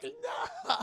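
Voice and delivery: high, weak mock wail; collapsing wail. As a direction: wail as if your legs give out. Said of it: "he's losing the ability to walk".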